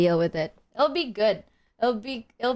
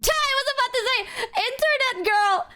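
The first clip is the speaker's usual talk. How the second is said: much higher song title; excited squeal. Pretend. Telling chat the song title, excited.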